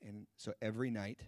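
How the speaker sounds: ordinary talk, nothing marked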